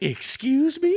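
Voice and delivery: indignant woman, high-pitched; imitating the annoyed woman answering, high; offended woman. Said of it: "She's like".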